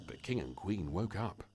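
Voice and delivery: usual speaking voice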